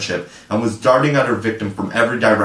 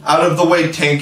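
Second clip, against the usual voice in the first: higher, louder shout; 6 semitones higher, 4 dB louder than usual. Acted. Toodles warns Tink before shooting, higher louder shout. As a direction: shout her aside before firing. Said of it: "he shouted".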